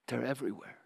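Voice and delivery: softly, imitating a wife's hushed warning; retold whisper. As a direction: pass on her secret words quietly, not a real whisper. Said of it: "she whispered in my ear".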